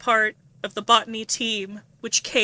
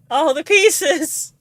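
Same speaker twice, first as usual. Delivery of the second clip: higher tearful wail, slow; breaking into tears on pieces; crying out; 6 semitones higher, 8 dB louder, slower than usual. Real, not acted. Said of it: "I'm crying".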